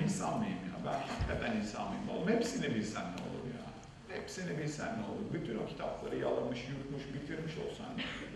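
muttered self-reproach, barely voiced; ashamed self-reproach. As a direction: quietly ask yourself how you could do it. Ashamed, questioning himself inwardly.

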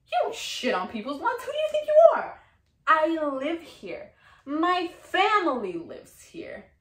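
loud, high, escalating rage; furious scolding. Acted. Woman confronts the man fouling her lawn, furious shouting. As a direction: build to a shouted, indignant confrontation. Acted attack and scolding of intruder.

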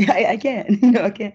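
amused laugh while admitting she can't. Real, not acted. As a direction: break into real laughter mid-sentence. Laughs about open mics.

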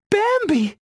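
very high-pitched startled call; crying out. A narrator's reading. Feline spotting her friend behind the trees, high cry. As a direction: cry the name out in a little high voice. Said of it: "Feline cried".

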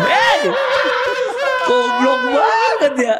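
said through laughter, slow; laughing. Real, not acted. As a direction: laugh the words out. Laughing at own story. (sambil tertawa terbahak)

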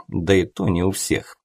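ordinary delivery, nothing marked

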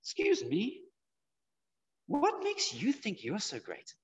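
high, soft, incredulous challenge; mock indignant. Nobody challenging Mister Mandela's greatness, mock indignant. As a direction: act affronted, slow and drawn out. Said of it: "you wouldn't say this".

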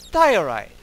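loud, sudden announcement; surprised exclaim. Real, not acted. Miner finds strange stone, loud surprised call.

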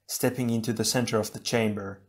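usual speaking voice

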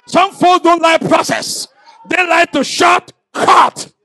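loud, slow and emphatic sermon; preaching at full force. Acted. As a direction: pound each word from the pulpit.

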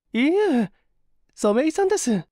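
put-on high, light voice of a young woman; young woman's voice. A narrator's reading. A young woman asks her companion if he's cold, high voice.

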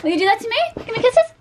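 very high cooing to an animal; pet talk. Real, not acted. Owner asking the pet for kisses, high cooing.

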